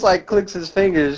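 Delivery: normal talking voice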